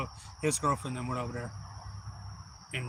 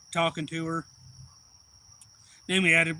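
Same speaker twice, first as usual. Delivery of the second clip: ordinary delivery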